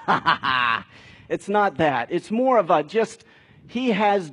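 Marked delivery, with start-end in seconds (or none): 0.1-0.9 a short scornful ha ha ha; imitating God scoffing with a laugh; mocking laugh. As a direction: laugh it off as mock derision, lightly. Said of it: "he's like".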